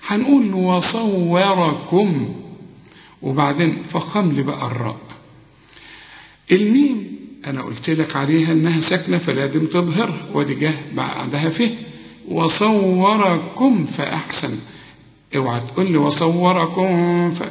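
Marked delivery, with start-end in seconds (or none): 0.5-2.3 وصوركم slow, light و, heavy ص; slow model recitation. Shown as a demonstration. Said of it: "الصاد فخمها، الواو رققها". 12.3-14.6 وصوركم فأحسن slow and drawn out; slow careful recitation. Shown as a demonstration. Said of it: "فأحسن كلها مرققة".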